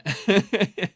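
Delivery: loud high laugh; burst of laughter. Real, not acted. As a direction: break out laughing. Host laughs at guest's secret.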